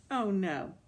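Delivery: ordinary delivery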